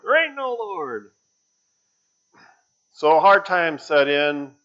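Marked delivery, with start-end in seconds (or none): 0.0-1.1 shouting back. Acted. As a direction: fire the answer right back. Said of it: "a shouting battle".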